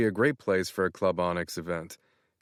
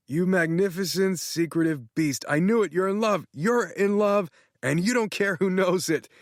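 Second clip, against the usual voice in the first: much higher, gushing excited friend; excited Tanner; 10 semitones higher than usual. Acted. Tanner thrilled, congratulating Matt on being in love. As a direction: gush as if he just found out.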